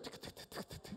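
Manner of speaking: quiet voiceless run of ticks; soft mechanical ticking; imitated ride clicking. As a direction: click like a chain lift starting to climb. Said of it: "and then you start".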